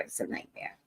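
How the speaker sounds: voiceless muttered complaint; under your breath; whispered aside. Real, not acted. Aside about the long airport wait.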